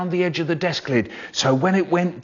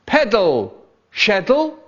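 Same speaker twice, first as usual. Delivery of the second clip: short, raised boss's shout; shouted order; 6 semitones higher, 4 dB louder than usual. A narrator's reading. Boss shouting at his worker at the sewing machine, shouted. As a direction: call it out sharply as an order. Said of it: "so he shouted".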